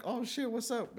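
high-pitched retold hello, falling at the end; surprised greeting. Spotting a childhood friend at the party, surprised greeting. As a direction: greet an old friend you didn't expect.

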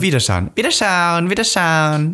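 high, slow sing-song Viennese Wiederschauen. Retold or imitated. Mimicking endless Viennese goodbyes, slow and sing-song. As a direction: chant Wiederschauen slowly like a Viennese shopkeeper. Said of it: "Aber nur".

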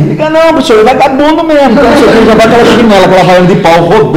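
high-pitched, loud mock mom voice; scolding mother. Mother running down her son to his teacher, scolding. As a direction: imitate a mother ranting about her kid.

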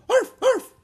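very high, loud yapping arf arf; small dog barking. A narrator's reading. Teddy the dog barking happily, very high yaps. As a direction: yap like a little puppy.

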